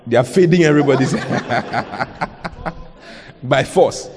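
laughing through the words. Real, not acted. Preacher amused by the forced haircut, laughing. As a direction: chuckle it out, amused at the joke.

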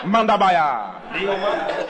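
slow, with a flat final close; mock tongues closing flatly; tongues with a full stop. Shown as a demonstration. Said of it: "Full stop".

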